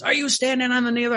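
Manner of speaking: high, fast imitated demand; deaf priest. Deaf priest questions the boy in the confessional, high and quick. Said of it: "the other thing too is he was deaf".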